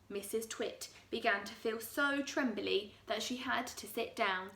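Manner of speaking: plain reading voice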